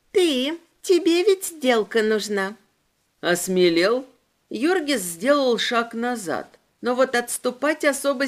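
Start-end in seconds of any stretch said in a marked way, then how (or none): 0.1-2.5 put-on creepy demon girl. A narrator's reading. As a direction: savor the words like a hungry child demon.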